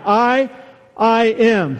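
"I" stressed alone, a pause, then "I am"; demonstrated emphasis on "I"; emphatic I. Shown as a demonstration. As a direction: lean firmly on "I" both times. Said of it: "There's an em-, emphasis there on I".

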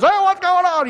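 high, nosy neighbor calling out; mock neighbor call. Neighbors calling over at the chaotic morning, high and nosy.

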